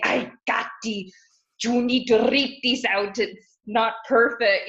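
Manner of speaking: put-on lightly done Peruvian mom's Spanish accent; stern mom in a Spanish accent. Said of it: "whenever I imitate her, I sound like an angry pirate, but it's just my Spanish accent".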